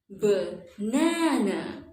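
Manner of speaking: BANANA slowly, short ba then long strong NA; stretched middle stress. Shown as a demonstration. Teacher demonstrating stress, slow Banana with long middle NA. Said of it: "the A sounds like".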